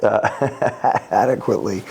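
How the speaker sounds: a short laugh at your own stumble, then the word. Real, not acted. Speaker musing on consultants' pay trips on a word, laughing. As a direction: chuckle through the word.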